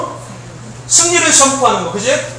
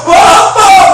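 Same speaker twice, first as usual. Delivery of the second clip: high, loud happy cry; hammed-up thrill, louder and higher; acted childlike delight; 9 semitones higher, 7 dB louder, much slower than usual. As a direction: play an overjoyed kid calling to dad. Acts a child thrilled at gift.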